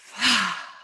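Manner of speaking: unvoiced "fa" exhale; whispered out-breath after holding; breathy exhale. Shown as a demonstration. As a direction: let the air rush out. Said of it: "and out through the mouth".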